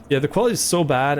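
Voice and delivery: ordinary delivery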